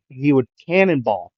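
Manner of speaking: ordinary delivery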